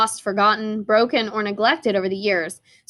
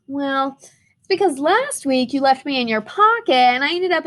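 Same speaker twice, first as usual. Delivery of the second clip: higher, bouncy and excited letter voice; cheerful stuck crayon; 5 semitones higher than usual. Acted. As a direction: chirp it like a goofy little buddy.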